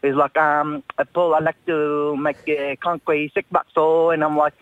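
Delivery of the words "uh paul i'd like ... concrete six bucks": imitated Chinese English, words broken up; Chinese accent. Builder quoting his Chinese concreter's request, Chinese accent. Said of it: "I picked up his accent".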